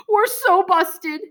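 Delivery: high, slow panicked wail; boy realising he's caught; panicked cry. Acted. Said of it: "he cried".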